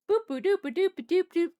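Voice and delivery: imitated scampering, high and loud; sing-song noise of a little creature trotting up; high bouncy boop-a-doop. Imitates approaching cat's little steps.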